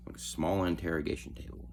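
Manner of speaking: ordinary talk, nothing marked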